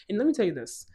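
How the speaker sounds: usual speaking voice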